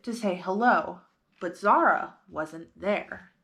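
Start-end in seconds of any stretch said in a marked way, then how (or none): none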